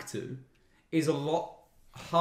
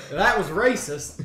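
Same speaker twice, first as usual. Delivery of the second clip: usual speaking voice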